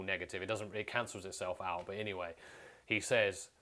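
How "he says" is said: ordinary talk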